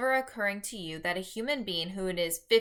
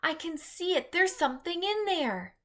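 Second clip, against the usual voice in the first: put-on high, excited little-girl cry; a child gasping out what she sees; 9 semitones higher than usual. A narrator's reading. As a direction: Sophie cries out, high and excited. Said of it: "cried out".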